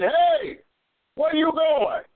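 high called-out holler. Retold or imitated. Preacher calling to man with knife, high holler. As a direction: call out to stop him. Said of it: "When I hollered at".

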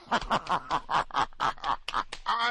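imitated cackling of the pranksters; put-on giggling fit; mock laughter. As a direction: laugh in mockery, a long rattling run.

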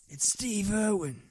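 Steve Irwin. Pretend. Defending a doubted impression, slow mock-Australian. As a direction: insist on it in the wildlife host's Australian voice. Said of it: "You sound like Johnny Knoxville".

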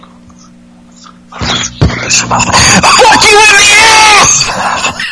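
high, slow, shouted punchline; mock shout. Host acting out Ambrose's threat to Ellsworth, slow mock shout. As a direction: bellow it out, stretched. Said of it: "It's gonna be like".

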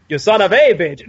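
put-on a hammy Dracula accent, stressing a; Bela Lugosi. Lugosi impression for the friends, hammy Dracula accent. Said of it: "if you're gonna speak like Bela Lugosi".

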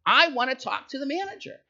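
a customer losing her temper, pushing harder; angry customer. Retold or imitated. Irate woman at the produce counter, demanding the manager. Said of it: "She gets angry".

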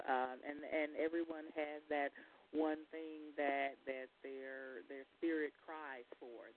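faint, far from the mic; barely audible. Real, not acted. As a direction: voice fading out on the line. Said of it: "I can barely hear you".

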